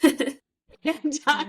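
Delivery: slow, high mimicry, stretched out; mock character echo. Co-host mimicking Dee Dee's question, slow high mockery.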